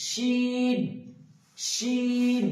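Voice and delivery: teacher contrasting শিন with সিন; sh without whistle. Shown as a demonstration. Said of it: "এটাতে কিন্তু ওই সিটিটা নেই".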